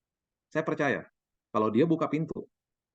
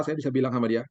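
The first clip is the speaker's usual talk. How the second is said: ordinary talk, nothing marked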